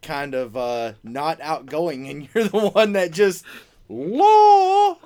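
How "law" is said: loud rising wail held long; mock sudden outburst. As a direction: imitate someone opening up with a sudden high holler. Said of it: "opens up all of a sudden".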